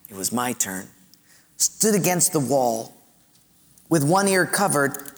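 ordinary delivery

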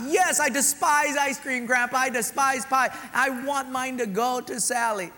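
high, loud overacted denial; forced boy. Boy giving up dessert, high forced insistence. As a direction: insist too hard.

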